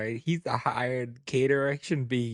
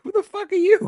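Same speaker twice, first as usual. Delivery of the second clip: much higher, louder mock outrage; indignant yell; 8 dB louder than usual. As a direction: yell it like you're offended at the intruder.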